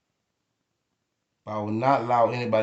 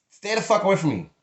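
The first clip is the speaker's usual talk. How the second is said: angry warning; 6 semitones higher than usual. Real, not acted. Ranting at would-be touchers, angry. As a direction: push them off hard.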